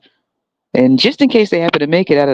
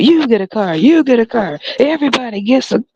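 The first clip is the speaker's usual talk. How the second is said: Oprah impression; 7 semitones higher than usual. Oprah running out to hand out cars, a lightly voiced Oprah. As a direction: give it away like a talk-show host. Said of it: "Oprah's gonna run out".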